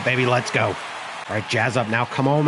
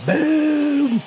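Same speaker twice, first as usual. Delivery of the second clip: excited; 13 semitones higher than usual. Real, not acted. Rookie's first hit celebrated.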